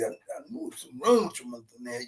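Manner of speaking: ordinary delivery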